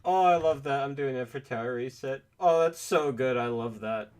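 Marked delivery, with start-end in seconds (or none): 0.0-0.8 delighted. Real, not acted. Delighted by effect.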